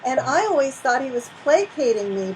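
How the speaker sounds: ordinary delivery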